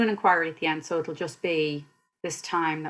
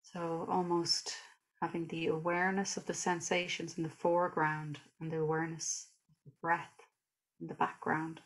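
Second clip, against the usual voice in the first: guided meditation; 5 dB quieter than usual. Real, not acted. Teacher leading listeners through a body scan, calm guided meditation. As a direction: guide them gently through the body, unhurried.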